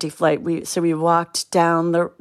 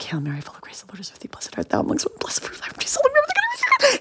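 hushed whispered Hail Mary; whispered prayer; 4 dB quieter than usual. Retold or imitated. Girls hiding on the plane praying in a whisper. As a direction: pray under your breath, scared stiff. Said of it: "saying Hail Marys".

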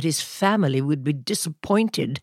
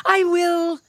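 put-on much higher, eager child voice; eager declaration. A narrator's reading. Crispin volunteers to light the candles, eager and high. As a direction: declare it proudly like a little kid. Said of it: "declared Crispin".

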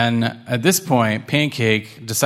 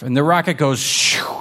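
usual speaking voice

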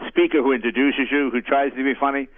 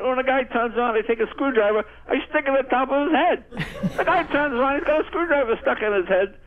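higher, excited mobster telling a story; mob guy; 8 semitones higher than usual. Retold or imitated. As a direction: ham up a giddy gangster's tale. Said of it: "He goes".

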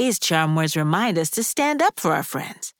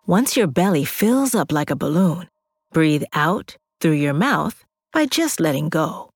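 guided breathing. Acted. Guides belly breathing with music.

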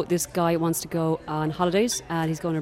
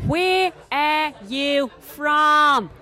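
very slow, louder question; 9 semitones higher, 6 dB louder, much slower than usual. Retold or imitated. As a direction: spell it out loudly for a foreigner. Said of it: "speak really loud and really slow".

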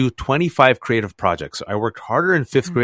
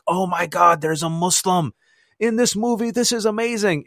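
higher, thrilled exclamation; excited; 7 semitones higher than usual. Retold or imitated. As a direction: gush like a starstruck kid. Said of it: "we're like".

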